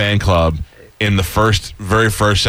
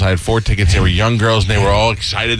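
ordinary talk, nothing marked

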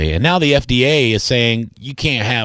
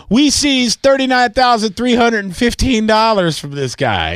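mock cop announcement; 8 semitones higher than usual. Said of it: "They never go".

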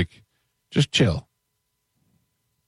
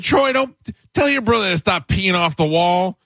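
mock scream; 11 semitones higher than usual. Narrator mimicking a dad screaming across the beach. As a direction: holler at the kid from far off. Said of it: "This screaming this across the whole place".

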